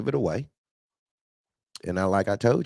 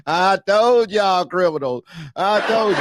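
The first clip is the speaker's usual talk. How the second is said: laughing I-told-you-so; 7 semitones higher, 6 dB louder than usual. Real, not acted. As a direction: crow it while cracking up. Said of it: "I'm laughing".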